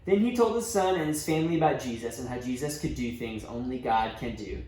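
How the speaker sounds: plain reading voice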